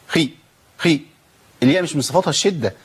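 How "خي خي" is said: خي repeated short, each ya chopped; sound cut off. Shown as a demonstration. Teacher repeating the mistake for students, short cut-off خي. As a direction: stop each خي at once, no lengthening. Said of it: "لو أنا قطعت الصوت".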